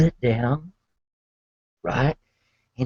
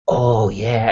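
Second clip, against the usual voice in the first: usual speaking voice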